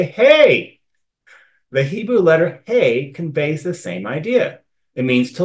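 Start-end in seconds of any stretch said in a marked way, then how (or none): 0.0-0.8 loud, high call-out; attention-getting hey. Shown as a demonstration. Teacher showing how you get someone's attention, calling out. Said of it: "to get someone's attention, you say".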